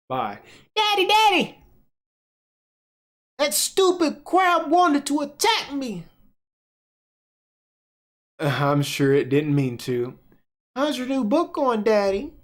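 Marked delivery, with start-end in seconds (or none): 0.8-6.0 put-on high, whiny child voice complaining to daddy; upset little girl. Reading the webtoon, Clara runs crying to her daddy, high whiny child. 10.8-12.3 put-on high, whiny child voice complaining to daddy; upset little girl. Reading the webtoon, Clara runs crying to her daddy, high whiny child.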